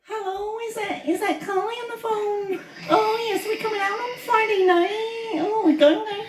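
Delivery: very high Scouse woman on the phone; female Scouse accent. Shown as a demonstration. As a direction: chirp it like a Liverpool girl ringing her mate. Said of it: "the female Scouse accent".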